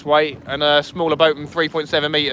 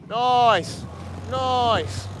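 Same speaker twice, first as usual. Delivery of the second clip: drawn-out nice; 5 semitones higher, 5 dB quieter than usual. Real, not acted. Pleased remark.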